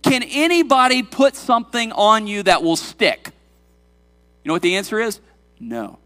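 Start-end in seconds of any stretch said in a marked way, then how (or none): 0.0-3.3 raised pitch, pressing rhetorical questions; impassioned preaching. Real, not acted. Preacher challenging any accuser of the congregation, impassioned. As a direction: preach it out with conviction, higher and firmer.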